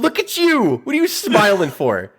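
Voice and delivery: high-pitched teasing commentary; mock voiceover. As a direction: imitate a streamer needling the wrestler on screen. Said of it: "like, doing the voiceover".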